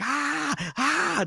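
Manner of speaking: high-pitched imitated screams; big screams. Imitating young moviegoers screaming in the theater, mock screams. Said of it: "like, the big screams".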